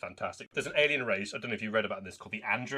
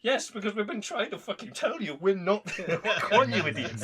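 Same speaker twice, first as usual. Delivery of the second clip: exasperated, laughing; 9 semitones higher, 3 dB louder than usual. Retold or imitated.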